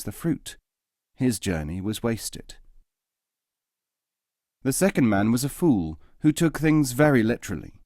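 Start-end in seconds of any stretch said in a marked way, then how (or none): none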